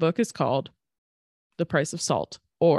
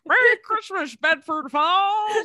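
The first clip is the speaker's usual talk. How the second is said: high, slow, loud Jimmy Stewart voice; Jimmy Stewart impression; 12 semitones higher, 6 dB louder, slower than usual. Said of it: "you're Jimmy Stewart from fucking It's a Wonderful Life".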